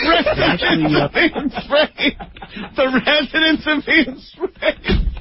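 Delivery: high laughing repeat. Real, not acted. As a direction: laugh through the words.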